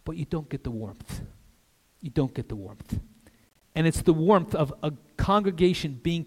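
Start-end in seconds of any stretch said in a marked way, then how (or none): 0.1-3.0 quiet letdown after the build-up; hushed regret. Real, not acted. Preacher on what live streaming lacks, quietly sober. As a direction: drop to a soft, sober tone.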